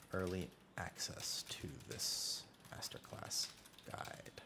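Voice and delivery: soft whispered read-out of a title. Real, not acted. Streamer whispering the post title he types. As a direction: whisper the words as you type them.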